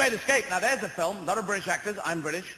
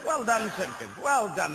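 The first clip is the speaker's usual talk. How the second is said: posh officer praise; 6 semitones higher, 3 dB louder, slower than usual. Retold or imitated. Officer praising, Monty Python line.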